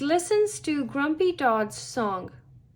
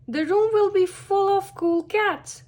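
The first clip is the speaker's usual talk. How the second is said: higher, eager storybook voice; picture a great crowd, pitched up; excited character; 8 semitones higher, 4 dB louder than usual. A narrator's reading. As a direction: lift the pitch with enthusiasm. Pete enthuses about the show.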